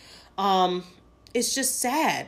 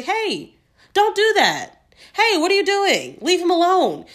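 raised, higher shout of hey, don't do that; calling out to stop; 8 semitones higher, 4 dB louder than usual. Retold or imitated. Bystander yelling at the shooters to stop, raised urgent shout. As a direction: yell at them to stop, drawn out and urgent.

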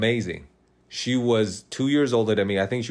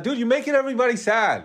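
higher, fast mock rebuke; hammed-up dude telling her off; laughing fake scold; 11 semitones higher, faster than usual. Retold scolding the crying girl.

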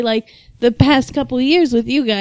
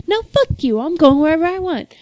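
defiant; 8 semitones higher than usual. Pretend. Defiant retort to chaperone.

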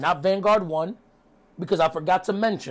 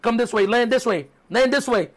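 mock frantic, higher-pitched calling out; exasperated, raised-pitch pleading turning to cursing; Chris Jericho; 5 semitones higher than usual. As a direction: imitate someone upset, calling it back. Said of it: "Chris Jericho's upset".

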